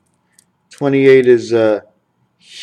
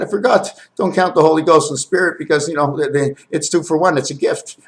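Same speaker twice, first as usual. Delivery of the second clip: ordinary talk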